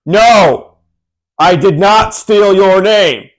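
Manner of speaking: Cody Rhodes. Acted. Co-host imagining the wrestler answering a band's lawsuit, declaimed impression. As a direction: deliver a big drawn-out mock denial. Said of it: "'Cause that's what he sounds like".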